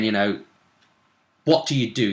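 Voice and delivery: normal talking voice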